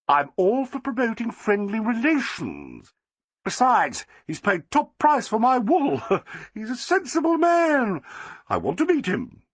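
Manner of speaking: high, gushing society lady; Flossie, delighted. A narrator's reading. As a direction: crow with triumph, slowing at the end.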